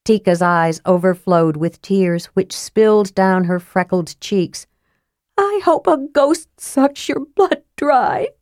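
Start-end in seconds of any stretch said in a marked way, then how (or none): 5.4-8.3 angry sobbing. A narrator's reading. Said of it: "she sobbed angrily".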